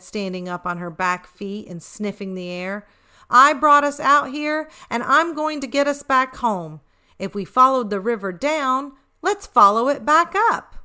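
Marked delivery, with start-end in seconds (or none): 3.3-10.8 higher, louder, rallying the pack; determined rallying. Acted. Lucy rallying her lost siblings, higher and louder. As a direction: lift them up with resolve.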